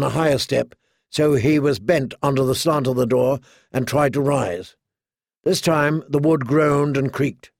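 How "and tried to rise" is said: plain reading voice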